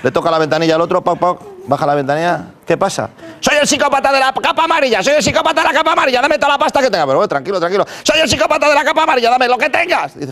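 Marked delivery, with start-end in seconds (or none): 3.4-7.0 much higher silly villain voice; a hammed-up menacing psychopath, pitched way up; psycho robber voice. Robber in cape stops driver. 8.0-10.1 much higher silly villain voice; a hammed-up menacing psychopath, pitched way up; psycho robber voice. Robber repeats demand.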